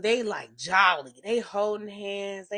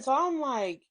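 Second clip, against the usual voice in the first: usual speaking voice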